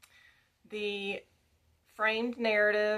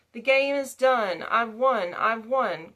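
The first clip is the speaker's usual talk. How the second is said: louder, raised cry of victory; triumphant exclamation; 3 semitones higher, 8 dB louder than usual. A narrator's reading. Spectre woman wins at dice, loud triumphant cry. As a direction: proclaim the win boldly within the recitation.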